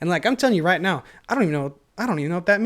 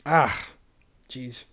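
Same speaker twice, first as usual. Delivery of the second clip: ordinary talk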